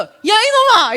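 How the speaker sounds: sharp high shout at her son; scolding shout. Retold or imitated. Mother yelling at her son over the movie. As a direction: yell 이놈아 like a mother losing patience.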